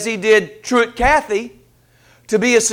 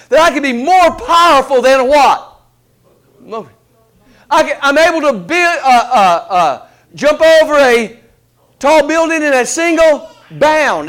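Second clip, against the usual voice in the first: mock boasting; 5 semitones higher, 5 dB louder than usual. Mock Superman boasts, rising excitement.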